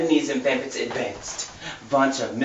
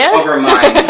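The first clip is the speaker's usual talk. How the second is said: laughing correction; 10 dB louder than usual. Real, not acted. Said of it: "Over me?".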